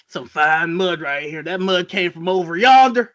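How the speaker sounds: mock Southern twang; cartoony Southern accent. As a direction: play a folksy Southern tracker. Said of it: "that cartoony southern accent".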